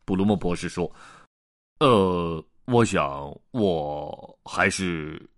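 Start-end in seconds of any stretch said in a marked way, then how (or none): 1.8-5.3 slow, lower, halting words; drawn-out uncertain musing; hesitant pondering. Acted. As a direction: think aloud, dragging each phrase. Blum hesitantly reconsiders career.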